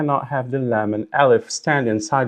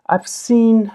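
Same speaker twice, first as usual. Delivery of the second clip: ordinary delivery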